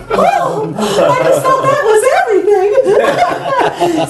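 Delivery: high-pitched, slow, laughing faux-innocent reply; high naive reply. Retold own naive reply, high.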